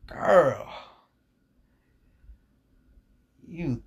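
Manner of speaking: ogling an Instagram model, a touch over the top; swooning over a photo. Real, not acted. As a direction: let it out like you can't help yourself. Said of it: "I gotta calm myself down".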